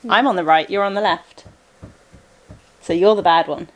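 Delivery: normal talking voice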